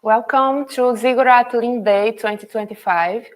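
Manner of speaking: ordinary talk, nothing marked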